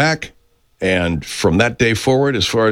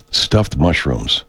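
normal talking voice

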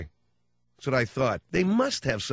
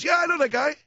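high, loud sudden recognition; excited bus boy shout; 5 dB louder than usual. Retold or imitated. Bus boy to hostess, excitedly shouting. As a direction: yelp it out in delight.

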